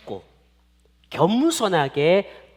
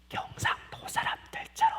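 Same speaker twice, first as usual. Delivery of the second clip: hushed, voiceless whisper; whispered aside; 9 dB quieter than usual. Real, not acted. Preacher teases about Gyeongsang people, whispered aside. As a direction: slip it in as a secret.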